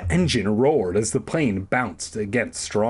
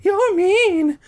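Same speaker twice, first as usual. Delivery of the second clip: very high, loud squeaky woman voice; Carolyn voice; 6 dB louder than usual. A narrator's reading. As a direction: push up into shrill falsetto. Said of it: "she told Ernesto".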